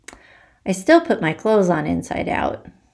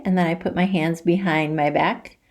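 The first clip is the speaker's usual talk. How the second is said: usual speaking voice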